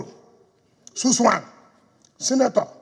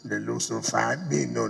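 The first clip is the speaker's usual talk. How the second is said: normal talking voice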